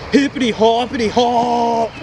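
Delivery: loud singsong call; playful shout. Acted. Host cheering on hopping kangaroos, playful shout. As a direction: bounce the words like hops.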